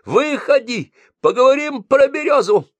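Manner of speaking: bang and holler at the door; landlady hollering at Mityay's door; yelling. Acted. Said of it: "колотилась она".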